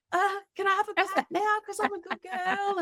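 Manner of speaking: high-pitched pleading pet voice; eager dog voice. Acted. Good dog asking the vet for a pat, high eager voice.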